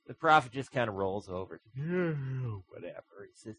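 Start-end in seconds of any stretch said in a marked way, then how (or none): 1.8-3.3 very slow, unbothered drawl; dismissive shrug. Acted. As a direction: brush it off, half asleep. Prophet rolls over unbothered.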